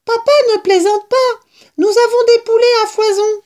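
put-on very high, loud child-like voice; squeaky little-one exclaiming; excited fox cubs. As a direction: insist like eager little cubs. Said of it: "s'écrièrent les renardeaux".

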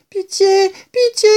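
high, quavering mouse begging for mercy; quavering plea. A narrator's reading. Terrified mouse begging the kitten for pity, quavering plea. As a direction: plead in a high, trembling little voice. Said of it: "supplia-t-elle d'une voix chevrotante".

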